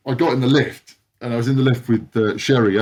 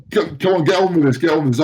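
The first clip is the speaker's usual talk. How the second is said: laughing urging; 6 semitones higher, 3 dB louder, faster than usual. Retold or imitated. And I just started crying.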